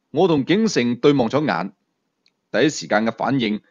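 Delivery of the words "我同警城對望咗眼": ordinary delivery, nothing marked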